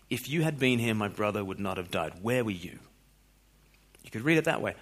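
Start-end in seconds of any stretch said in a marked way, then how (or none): 0.1-2.8 understated accusing reproach, falling at the end; accusation. Shown as a demonstration. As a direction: quietly lay the blame on the listener. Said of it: "read that as an accusation".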